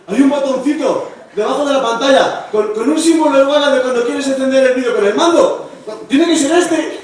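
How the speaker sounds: high excited discovery, rising to frantic yeses; building excitement. Acted. Acting out sudden hope on finding button.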